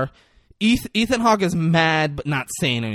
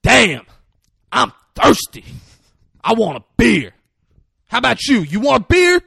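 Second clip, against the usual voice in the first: loud, high, punched-out exclamations; hammed-up hearty shouting; Denzel impression; 8 semitones higher, 9 dB louder than usual. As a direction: boom it out in separate bursts. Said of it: "and Denzel goes".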